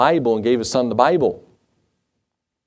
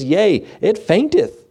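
higher, slow and fervent; yearning psalmist; 7 semitones higher, slower than usual. Acted. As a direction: lift it up, yearning. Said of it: "he says".

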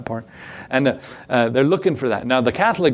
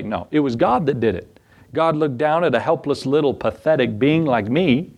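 normal talking voice

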